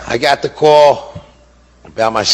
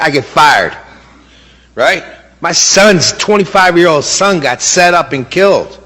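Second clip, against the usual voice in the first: rising outrage; 8 semitones higher than usual. Real, not acted. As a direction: let the anger at the cover-up boil over. Grieving father's outraged rant.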